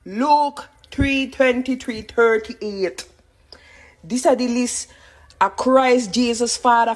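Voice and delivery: ordinary talk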